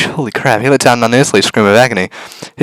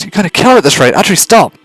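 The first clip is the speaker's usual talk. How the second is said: urgent higher shout; 9 semitones higher, 4 dB louder than usual. Acted. Pleading with her to stop.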